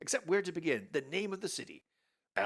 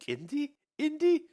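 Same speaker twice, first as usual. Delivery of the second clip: Elsa voice; 9 dB louder than usual. Acted. Elsa calling.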